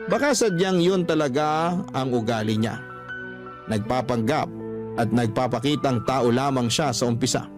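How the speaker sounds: ordinary talk, nothing marked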